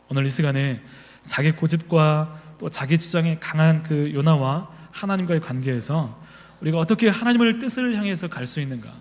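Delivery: ordinary talk, nothing marked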